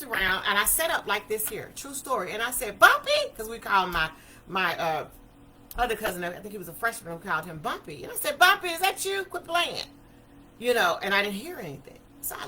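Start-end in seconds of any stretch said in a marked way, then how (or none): 2.8-3.1 sudden high, loud call of a name; startled call to a cousin in the next room. Retold or imitated. Calling out to cousin. 8.4-9.8 high, loud nervous call; uneasy call to a cousin she thinks is joking. Retold or imitated. Calling out to cousin.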